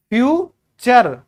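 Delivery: फ्यूचर drawn out slowly as fyoo-cher; slow teacher model of फ्यूचर; slow model word. Shown as a demonstration. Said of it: "T U R E ... चर".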